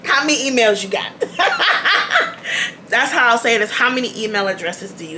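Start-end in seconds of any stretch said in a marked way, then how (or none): 0.1-1.1 cheeky question through laughter; laughing hint. Real, not acted. Cheeky hint, laughing before and after. 1.2-2.8 high-pitched burst of giggles; amused laugh. Real, not acted. Laughs at own cheeky question.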